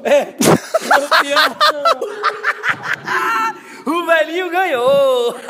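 high, drawn-out cheering; victory shout. Real, not acted. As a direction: celebrate the win at full voice. Celebrating the win.

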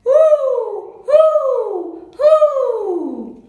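high, loud falling hoots; three long, high owl calls; owl hooting. A narrator's reading. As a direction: hoot like an owl. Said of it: "The owl says".

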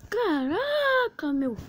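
high, slow, stretched caraca; drawn-out high shocked caraca; shocked cry. Real, not acted. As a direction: wail it out in disbelief. Shocked after dying.

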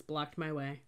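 ordinary delivery

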